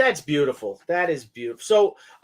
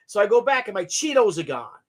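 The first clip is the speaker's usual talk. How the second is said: ordinary delivery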